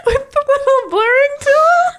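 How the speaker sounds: high, loud squeal through laughter; helpless high-pitched giggling delivery; cracking up. Real, not acted. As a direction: barely get the words out laughing. Cracking up over Photoshop joke.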